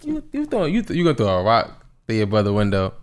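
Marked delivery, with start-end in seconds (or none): 0.0-1.2 disbelief. Real, not acted. Incredulous at rock throwing.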